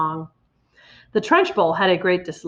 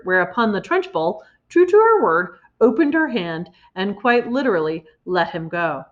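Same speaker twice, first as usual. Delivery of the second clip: usual speaking voice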